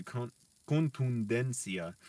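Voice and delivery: contundencia said slowly, every T, D and N apart; slow careful. Shown as a demonstration. Learner drilling a hard Spanish word, saying contundencia slowly. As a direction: sound out con-tun-den-cia slowly. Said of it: "That's why I have to say it slowly".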